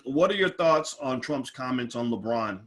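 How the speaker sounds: usual speaking voice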